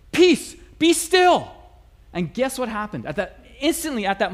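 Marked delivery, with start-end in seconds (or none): 0.1-1.5 loud, slow, booming command; commanding shout. Retold or imitated. Student commanding the storm from the boat's front, booming slow shout. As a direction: bellow it like a prophet at the storm. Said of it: "he just stands up and he just says".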